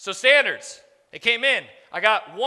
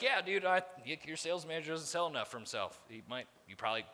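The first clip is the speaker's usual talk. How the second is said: softer, quick, throwaway tone; offhand verdict; 10 semitones lower, 13 dB quieter, faster than usual. Retold or imitated. Consultant casually telling the boss to fire the sales manager, soft and quick. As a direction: toss off the bad news casually and quietly. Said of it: "he was like".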